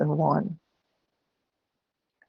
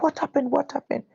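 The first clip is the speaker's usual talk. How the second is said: higher, quick alarmed "What happened?"; alarmed question; 8 semitones higher than usual. Retold or imitated. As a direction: ask in sudden worry, mildly voiced. Girl alarmed by her scream.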